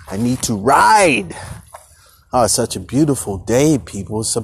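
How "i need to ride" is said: slow, stretched "ride" in an altered tone; drawn-out emphatic repeat. Real, not acted. Podcaster insisting he has to go ride, slow and drawn out. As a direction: lean into the need to ride.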